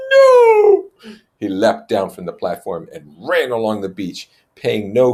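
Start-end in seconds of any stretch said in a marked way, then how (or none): 0.1-0.9 very high, loud furious cry; high-pitched enraged cry. Acted. Jack crying out at Ralph in rage. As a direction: scream it in tearful rage. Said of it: "he cried out in high-pitched enrage".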